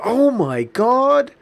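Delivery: high, loud, slow gasp of alarm; freaked out receptionist. Retold or imitated. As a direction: shriek it like she just saw blood. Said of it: "she kinda freaked out".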